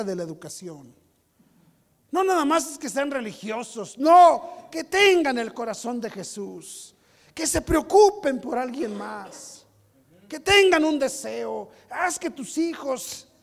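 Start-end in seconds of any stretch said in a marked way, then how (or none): 2.1-13.3 loud, slow preacher's emphasis; forceful preaching. Real, not acted. Pastor urging his church on raising children, forceful preaching. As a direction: drive each point home, loud and drawn out.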